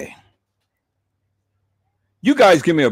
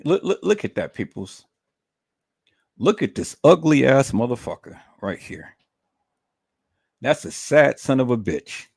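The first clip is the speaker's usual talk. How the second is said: ordinary talk, nothing marked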